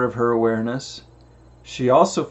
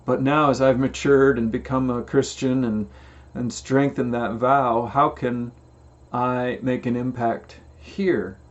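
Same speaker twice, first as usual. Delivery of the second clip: ordinary talk, nothing marked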